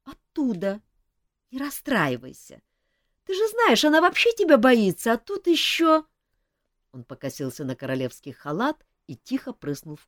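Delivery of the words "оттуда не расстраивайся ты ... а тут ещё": high, light, soothing; consoling friend. Acted. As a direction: reassure him kindly. Mafey consoles king.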